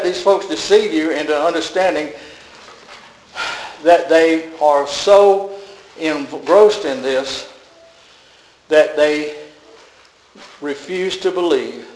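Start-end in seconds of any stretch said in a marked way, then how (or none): 3.8-11.9 very slow, weighty words; slow deliberate warning. Real, not acted. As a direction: drag each word out for weight. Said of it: "slowed emphatic warning".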